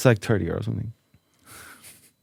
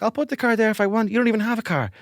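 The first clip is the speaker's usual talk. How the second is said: defiant retort; 11 semitones higher than usual. Retold or imitated. Defiant reply to angry neighbour.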